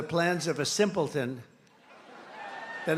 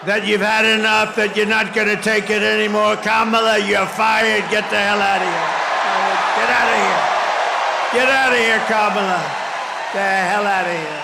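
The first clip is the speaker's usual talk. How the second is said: loud, raised, punching every phrase; rally shout; 3 semitones higher, 7 dB louder than usual. Real, not acted. Rally speaker shouting at Kamala to get out. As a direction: shout it to the crowd like a firing.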